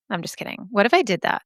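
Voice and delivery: ordinary talk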